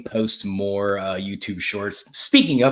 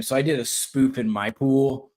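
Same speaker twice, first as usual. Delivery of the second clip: ordinary delivery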